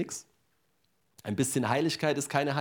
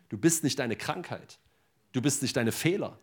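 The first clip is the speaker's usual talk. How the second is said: ordinary talk, nothing marked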